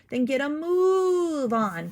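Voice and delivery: loud, slow command; impatient cat orders; ordering. A narrator's reading. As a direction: bark the order at the cow. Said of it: "Cat ordered".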